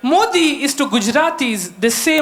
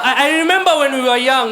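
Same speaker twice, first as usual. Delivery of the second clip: ordinary talk, nothing marked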